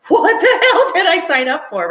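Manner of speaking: high, loud and half-laughing; laughing dismay. Retold or imitated. Angel landing on earth, giggling in mock horror. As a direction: blurt it out in laughing regret. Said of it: "they're like".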